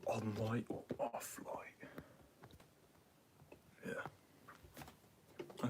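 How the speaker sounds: hushed whisper, slow. Real, not acted. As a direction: whisper the question. Asking helper quietly off-camera.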